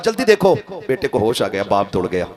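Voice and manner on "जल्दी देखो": urgent call. Acted. Mother calls husband urgently.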